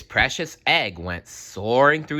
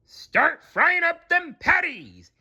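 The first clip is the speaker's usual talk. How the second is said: high, slow hearty boss; happy to see his cook; cheerful yell; slower than usual. A narrator's reading. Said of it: "yelled Mister Krabs cheerfully".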